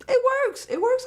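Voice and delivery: high squealed verdict; squealing approval. Real, not acted. Viewer approving his nineteen-thirties suit, high squeal.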